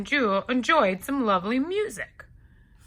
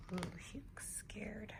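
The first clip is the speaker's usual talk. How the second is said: much softer, a sly whisper to the listener; whispered aside; 24 dB quieter than usual. Acted. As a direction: breathe it like a secret. Giant's gleeful aside.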